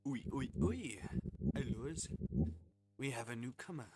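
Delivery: hammy, badly faked French chef; put-on French accent for a game character; attempted French accent. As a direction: read it as a French chef, poorly. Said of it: "Can't do a French accent, I can't".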